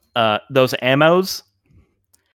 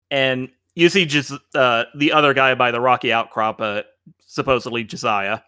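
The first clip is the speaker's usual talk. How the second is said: ordinary delivery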